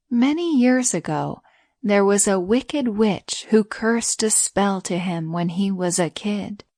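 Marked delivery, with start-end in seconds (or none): none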